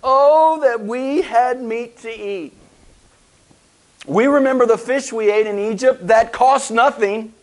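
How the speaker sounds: high whining complaint; whiny voice. Acted. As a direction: whine it like a spoiled child. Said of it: "said in a whiny voice probably".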